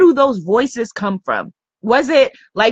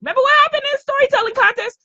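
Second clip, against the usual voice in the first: a hint of a panicky inner child, climbing higher and faster to the end; inner fourth grader; 8 semitones higher, faster than usual. Coach voicing her fourth-grade inner critic, rising higher and faster. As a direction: let the scolding kid voice rush up in pitch. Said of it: "That fourth grader saying".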